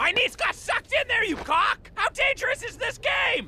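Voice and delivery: high, angry, shouted; furious yelling. Acted. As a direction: scream at him in rage. Gary furious at Glade.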